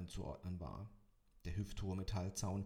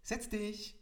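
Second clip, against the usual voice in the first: eager sister order; 13 semitones higher than usual. A narrator's reading. Sister orders him to sit.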